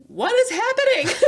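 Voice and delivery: very high, loud, laughing disbelief; stunned fan. Retold or imitated. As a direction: squeal their confusion.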